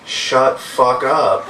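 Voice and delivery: very slow imitation of a cute toddler; sweet two-year-old voice. Two-year-old son copying mom to the bum, cute toddler voice. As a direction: mimic a toddler sweetly cursing, word by word. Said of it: "in his sweet, cute two-year-old voice".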